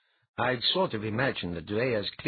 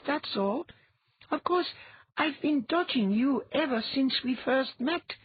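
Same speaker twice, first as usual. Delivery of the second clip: put-on high boyish voice, matter-of-fact; an offhand young boy explaining; boy Geher. A narrator's reading. Geher explaining Latha's gift to Ilya, boyish voice.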